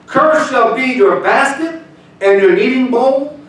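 high, loud, slow proclaiming; preached scripture. Acted. Pastor reading curses to the church, loud and slow. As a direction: thunder the curses from the pulpit.

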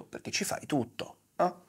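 usual speaking voice

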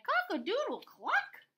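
high, loud cock-a-doodle call; rooster crow. Acted. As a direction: crow it out with leaping pitch.

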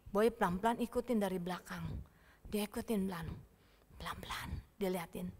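low, hushed sneaking narration; stealthy hush. A narrator's reading. Telling children how Boy follows from behind, hushed and stealthy. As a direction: drop toward a whisper as he creeps.

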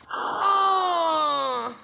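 mock cry of pain as the knife hits; a hammed-up wounded yell; pained scream. Stabbed in the game, yelling at the attacker in mock pain.

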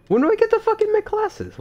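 very high, loud squawked question; exasperated outburst. Real, not acted. Gamer asking again about making classes, exasperated high squawk. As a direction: demand it in squealing exasperation.

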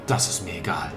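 whispered cold dismissal. Acted. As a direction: hiss it with no care.

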